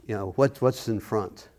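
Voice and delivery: ordinary talk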